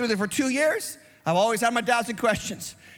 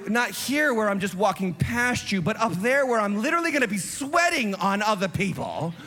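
ordinary talk

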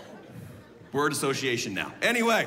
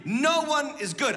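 ordinary delivery

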